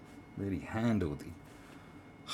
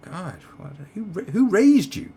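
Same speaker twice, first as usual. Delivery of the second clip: outraged; 11 semitones higher, 9 dB louder than usual. Retold or imitated. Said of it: "It was like".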